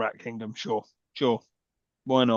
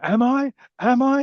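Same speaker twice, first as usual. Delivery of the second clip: mock Charlie; 11 semitones higher, 5 dB louder, slower than usual. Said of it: "And he's like".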